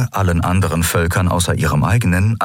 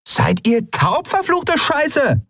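slow, very high-pitched angry yell; furious high holler; exasperated shout; slower than usual. A narrator's reading. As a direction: yell it drawn out at people who won't listen. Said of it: "rief die Frau wieder".

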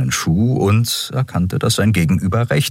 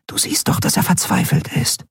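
hushed plea; 13 semitones higher than usual. Acted. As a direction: urge your friend under your breath. Nelen pleads quietly. (leise, geflüsterte Bitte)

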